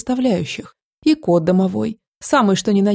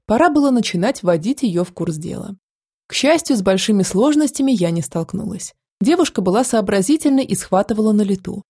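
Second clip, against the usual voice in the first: ordinary delivery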